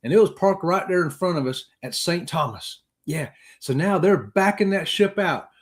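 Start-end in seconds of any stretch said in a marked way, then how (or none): none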